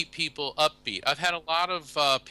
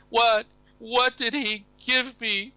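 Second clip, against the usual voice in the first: put-on high, slow fluttery old lady voice; little old lady; 10 semitones higher, 4 dB louder, slower than usual. Widow asks medium about her late husband, high old lady voice.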